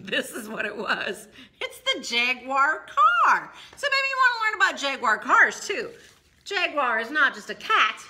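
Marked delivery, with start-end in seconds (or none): 0.0-5.9 laughing. Real, not acted. Laughs at car book mix-up.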